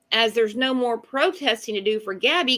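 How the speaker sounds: ordinary talk, nothing marked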